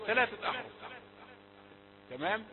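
usual speaking voice

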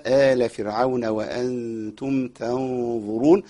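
slow, measured chanting of the verse; Quran recitation. Shown as a demonstration. Teacher recites the verse for students, slow chant. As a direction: recite it calmly with long vowels.

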